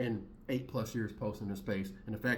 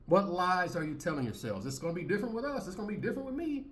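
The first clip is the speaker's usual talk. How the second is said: mocking quote; 7 semitones higher than usual. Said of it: "I'm like".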